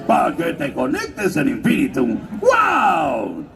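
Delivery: put-on cartoon dog voice; Otto. Dog mascot selling internet in an ad, in a put-on character voice. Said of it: "era el perro Otto de Telmex".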